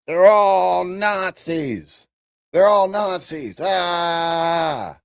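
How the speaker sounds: high, drawn-out mock wail; mock panic. Streamer mimicking people who see Nazis everywhere, mock panic. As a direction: wail it in exaggerated alarm, ending on a long cry.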